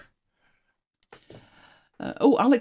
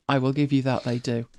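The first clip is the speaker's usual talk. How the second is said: ordinary talk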